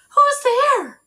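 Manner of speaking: high, loud startled call. Acted. Baby woken at night, calling out startled. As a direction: wake up and call out alarmed. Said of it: "she said".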